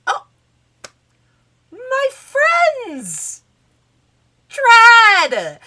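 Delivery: high, loud squeal of delight; overjoyed greeting. Acted. Cinderella spots her friends, overjoyed high shout. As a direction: cry out, drawing the words out high.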